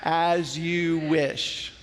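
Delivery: very slow, drawn-out movie quote; slow lead for a group recital; all together. Acted. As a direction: stretch each word so a crowd can join. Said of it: "Everyone all together".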